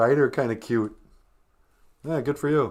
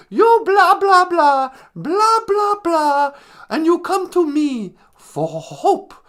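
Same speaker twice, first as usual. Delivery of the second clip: very high, loud mock scolding; Greta Thunberg; 13 semitones higher, 8 dB louder, slower than usual. Activist scolds those who come for hope, mock high tirade. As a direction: rant in a hammed-up high voice, stretching the end. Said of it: "Greta Thunberg".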